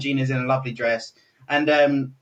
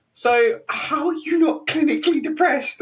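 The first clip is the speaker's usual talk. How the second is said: higher-pitched mock-baffled question; imitating incredulous questioner; 10 semitones higher than usual. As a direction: put on a stranger's baffled, high-pitched voice. Stranger's tactless question, acted.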